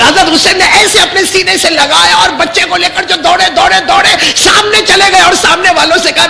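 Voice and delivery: high, loud wailing narration; lamenting sermon climax. Acted. Preacher wailing over Ali Asghar's thirst. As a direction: build the grief to a cry.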